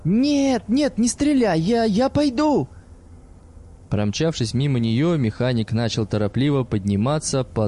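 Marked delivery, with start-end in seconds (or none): 0.0-2.7 high, panicked begging; terrified wail. A narrator's reading. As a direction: plead for your life, shrieking. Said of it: "истошный вопль Бориса".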